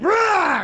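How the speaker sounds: sudden high loud roar; rage roar. Retold or imitated. He acts out roaring as he tosses logs, high and loud. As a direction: roar like you're heaving a log. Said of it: "I'm like".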